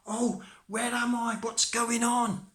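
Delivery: high, loud, drawn-out alarm; bewildered panic. Acted. Tom inside the cow's mouth, high panicked cry. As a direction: cry out in mounting confusion.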